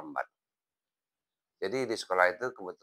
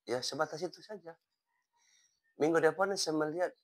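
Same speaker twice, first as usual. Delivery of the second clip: usual speaking voice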